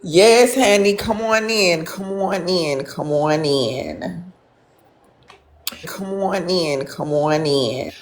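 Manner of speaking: slow, sing-song "come on in"; welcoming. Real, not acted. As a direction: invite them in, drawing it out. Welcoming viewer into the stream.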